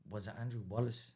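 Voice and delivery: ordinary talk, nothing marked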